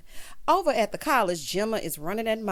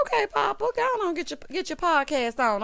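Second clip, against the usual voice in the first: much higher, playful hype-up voice; mock cheering; 8 semitones higher than usual. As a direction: cheer Papa on like a teasing aunt. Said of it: "I'm like".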